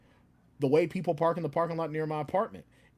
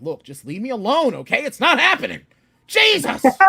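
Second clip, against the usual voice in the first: mounting yell, much higher and louder; angry outburst; 7 semitones higher, 10 dB louder than usual. Retold or imitated. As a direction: blow up like Joe Pesci in Goodfellas. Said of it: "until I finally get mad".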